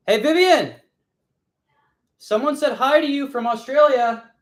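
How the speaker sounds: loud, high-pitched holler; calling out. Real, not acted. Relaying a viewer's hi to Vivian, calling out. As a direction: holler it to someone in another room.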